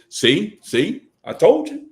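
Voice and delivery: a rising sing-song I told you; gloating coworker. Retold or imitated. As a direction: taunt like a smug coworker. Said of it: "what they gonna say is".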